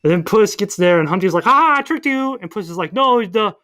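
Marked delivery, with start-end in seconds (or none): 1.4-2.4 high, loud mock gloating; gloating villain. Humpty gloating to Puss, high mock triumph. 2.9-3.6 high-pitched mock alarm; urgent warning. Puss warning Humpty of danger, high mock urgency.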